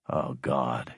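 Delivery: low, soft, weary groan of words; sighed. A narrator's reading. Lieutenant embarrassed by comparison to father, sighing. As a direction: sink the pitch and let it out quietly. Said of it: "he sighed".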